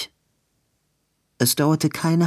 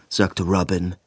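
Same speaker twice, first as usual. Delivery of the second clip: plain reading voice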